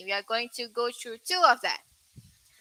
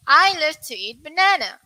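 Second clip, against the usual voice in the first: British pronunciation; 4 semitones higher, 6 dB louder than usual. Shown as a demonstration. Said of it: "U K and U S".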